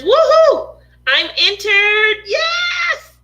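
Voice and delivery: loud, high-pitched whooping celebration; acted jubilant shout; excited cheer. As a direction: cheer it out like a winner, drawn out and high. Reads a viewer's excited comment aloud.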